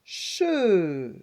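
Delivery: "che" loud, drawn out and falling; blended syllable. Shown as a demonstration. Teacher presenting the next syllable, loud and stretched. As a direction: hold both SH and vowel in "che". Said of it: "Ensuite, on a".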